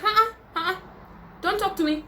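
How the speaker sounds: high, dismissive uh-uh; sassy refusal. Retold or imitated. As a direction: shut them down with a high sassy brush-off. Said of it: "I'm like".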